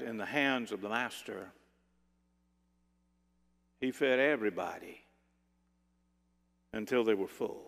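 low, soft, with long solemn pauses; hushed wonder, letting each phrase settle; earnest, gentle son. Acted. Boy telling his mother of the miracle, gently earnest.

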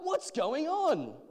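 loud, high, drawn-out question; calling out. A narrator's reading. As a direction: call over a noisy crowd. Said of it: "Bartimaeus called out".

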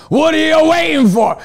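high, loud fired-up boxing coach yell; Rocky Balboa fire-up. Retold or imitated. Coach yelling to girls like Rocky Balboa, loud and high. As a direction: yell it like a trainer pushing a fighter. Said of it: "like Rocky Balboa".

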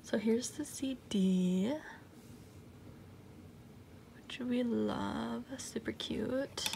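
slow, soft whisper. Real, not acted. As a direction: hush your voice while showing it. Showing the CD.

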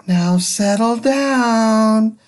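slow, high and sweet, gently coaxing the class; a patient teacher asking kindly for calm. Acted. Teacher Miss Nelson sweetly asks her noisy class to settle down. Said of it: "in a sweet voice".

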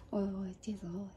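whispered aside. Real, not acted. Flustered aside to herself.

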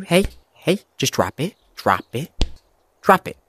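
soft coaxing, hushed and gentle; cooing to a scared pet. Pretend. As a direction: coax it softly like a nervous animal. Coaxing the character to drop it.

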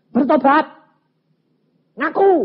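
high, loud forceful order; shouted command. Retold or imitated. Pastor orders the weeping husband to repent, shouted command. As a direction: shout it as a demand to confess.

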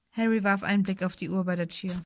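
ordinary delivery, nothing marked

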